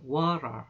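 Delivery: water said "wara", the T turned into a quick flap; flapped T water. Shown as a demonstration. Teacher models water for students to repeat, flapped T. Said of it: "해보세요".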